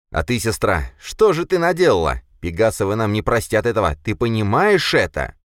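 heated, exasperated reproach; angry scolding. A narrator's reading. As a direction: berate your sister. Brother scolds sister.

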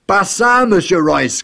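high, loud commanding cry; imperious cry. Acted. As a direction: stop him with a sharp order. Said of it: "cria Gilda impérieusement".

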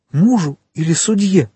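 high, frightened question; faltering babble. A narrator's reading. Wife asking whom she must answer, frightened faltering. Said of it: "пролепетала госпожа де Вильфор".

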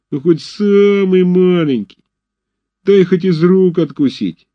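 high, slow sugary pleading; begging whine. A narrator's reading. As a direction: whine like a beggar child. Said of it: "умильным тоном".

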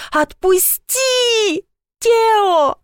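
high, drawn-out wail while fighting to break free; frantic high-pitched plea; desperate cry. A narrator's reading. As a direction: cry out for Тео in anguish. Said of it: "ещё громче попыталась отбиться".

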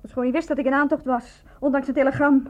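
usual speaking voice

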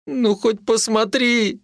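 high, drawn-out begging; pleading. A narrator's reading. Mitka begs the healer to look, pleading. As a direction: stretch the plea out, pitch way up. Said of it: "взмолился он".

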